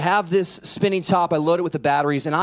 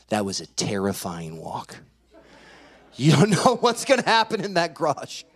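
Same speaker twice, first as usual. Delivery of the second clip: ordinary talk, nothing marked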